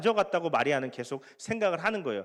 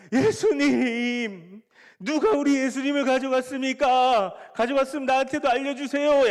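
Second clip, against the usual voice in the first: loud, high, slow mock wailing; hammed-up grieving cry over the empty tomb; wailing lament; 8 semitones higher, 6 dB louder than usual. Mary wailing at the tomb, hammed-up loud lament. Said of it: "연기력이 부족한데".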